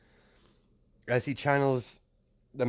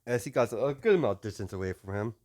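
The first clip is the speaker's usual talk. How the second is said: usual speaking voice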